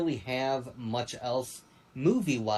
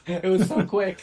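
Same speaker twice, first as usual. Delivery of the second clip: louder, higher, words breaking into laughter; laughing; 7 semitones higher, 7 dB louder than usual. Real, not acted. Host recalling Holly Holmes' knockout with co-hosts, laughing. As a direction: say it through a real laugh.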